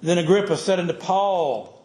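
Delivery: preacher reads Acts to his congregation, solemn and heavy; scripture preaching. Acted. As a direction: preach the passage with gravity.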